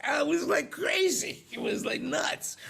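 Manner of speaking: thrilled, high-pitched burst ending in a shout; excited squeal. Real, not acted. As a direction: gush about it, voice flying up high. Thrilled the accent came back.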